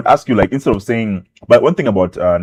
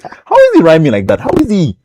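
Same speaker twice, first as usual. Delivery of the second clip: astonished, higher and louder; amazed; 9 semitones higher, 7 dB louder than usual. Retold or imitated. Boy hearing [name] for the first time, astonished. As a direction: marvel at how he rhymes. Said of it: "And I was like".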